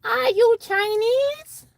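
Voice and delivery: very high, slow character voice; put-on squeaky question. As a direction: stretch it out way up high.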